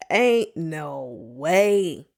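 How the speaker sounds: drawn-out, very slow ain't no way; slow emphatic. Real, not acted. Host insisting to listeners, very slow and drawn out. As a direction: stretch every word in disbelief.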